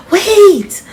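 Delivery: high, loud call after a friend. Acted. Maddie calling after Sophia, high and loud. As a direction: shout after someone running off. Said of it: "Maddie calling after".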